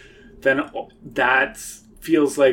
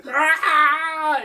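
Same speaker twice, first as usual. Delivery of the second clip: high scream; 13 semitones higher than usual. Acted. Faces scream from the walls.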